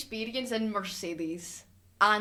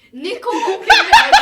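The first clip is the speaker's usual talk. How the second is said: put-on slow, much louder, higher English-accented cry, laughing; laughing English exclaim; 9 semitones higher, 14 dB louder than usual. Podcaster cracking herself up with a louder, higher English accent. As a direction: crow the name in an English accent through laughter. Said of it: "I don't know why I went English".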